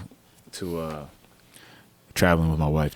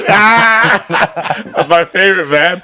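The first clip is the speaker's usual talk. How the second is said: laughing; 9 semitones higher, 4 dB louder, much slower than usual. Real, not acted. Laughing at his own dish joke.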